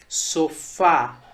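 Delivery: "sofá" said in parts, so-FÁ, weight on the end; stress on last syllable. Shown as a demonstration. Teacher giving an example word, "sofá" stressed on fá. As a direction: lean on the "fá" of "sofá". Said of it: "a sílaba mais forte é a sílaba fá".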